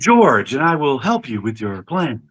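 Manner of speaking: high whale voice, sinking toward the end; George. Acted. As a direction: play a talking whale offering help. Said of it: "doing the whale voice".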